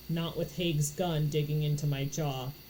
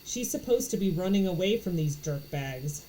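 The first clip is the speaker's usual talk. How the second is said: ordinary delivery, nothing marked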